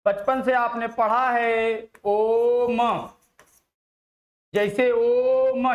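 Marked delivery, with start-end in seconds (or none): 2.0-5.7 ओम drawn out slowly, chant-like; prolonged Om. Shown as a demonstration. Teacher shows ओम held long and slow, like a chant. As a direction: hold ओम long and slow as in prayer. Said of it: "ये आपने इस तरीके से पढ़ लिया है".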